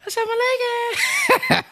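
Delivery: high mock greeting; imitating believers. Host teasing listeners by mimicking their greetings. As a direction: squeak the reply up high. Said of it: "Y'all be".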